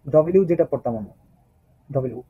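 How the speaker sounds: ordinary delivery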